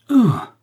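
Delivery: high, loud cry as if shot; sudden stricken "Ah!", falling away; anguished cry. A narrator's reading. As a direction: cry out as if struck in the heart. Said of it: "cria le mousquetaire comme s'il reçut une balle".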